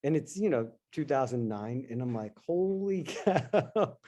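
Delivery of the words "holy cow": slow, higher, laughing holy cow; stunned laugh. Retold or imitated. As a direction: let the shock melt into a laugh. Shocked at halved retirement account.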